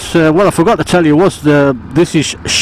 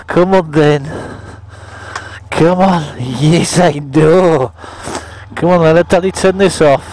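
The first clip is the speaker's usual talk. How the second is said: talking to a dog. Real, not acted. Greeting his little dog.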